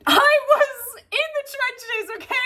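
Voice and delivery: high, drawn-out wail of mock despair; dramatic wail. Real, not acted. Mock-distressed outburst about a book.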